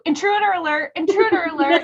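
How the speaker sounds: high, repeated alarm call; alarm announcement. Retold or imitated. Imitating the doorbell setting Josh changed, alarm announcement. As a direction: chant it like a security alert.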